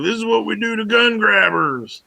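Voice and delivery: mock the tough guy's big line. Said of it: "He's like".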